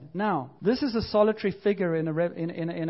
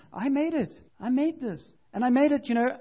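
higher, building claim of making it; excited insistence; 6 semitones higher than usual. Acted. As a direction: rise with pride on each "made". God insisting he made the ring.

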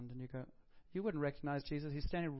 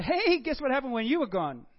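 loud, higher, bursting with news; excited disciples; 7 semitones higher, 11 dB louder than usual. Pretend. Disciples excitedly telling Thomas what he missed. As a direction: blurt it out like the best news ever. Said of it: "the disciples say".

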